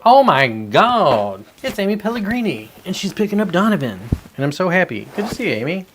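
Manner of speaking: high, swooping, delighted welcome; excited greeting. Real, not acted. Streamer welcomes a friend to the chat, excited.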